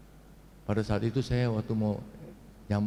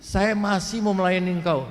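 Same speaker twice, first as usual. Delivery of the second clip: high, raised cry to God; insistent prayer, pitched up; retold shouted plea; 7 semitones higher, 4 dB louder than usual. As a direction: re-enact a forceful plea to keep serving. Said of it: "Saya teriaknya begitu".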